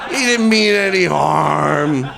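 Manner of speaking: slow and grudgingly forgiving, words stretched out; forgiving audience member. Retold or imitated. Imagined heckled man on the way home, forgiving. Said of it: "I think he'd be like".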